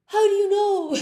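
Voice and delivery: loud, high mock shock; freaked out. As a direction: imitate a student aghast at being caught. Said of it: "they were freaked out".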